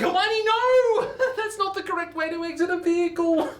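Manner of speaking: high, stretched, laughing cry of alarm; high shriek. Retold or imitated. Storyteller shrieking at the alpaca leaping from the Uber. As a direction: shriek after her as she jumps. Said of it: "And I'm like".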